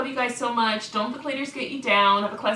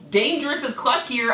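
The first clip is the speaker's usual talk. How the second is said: ordinary talk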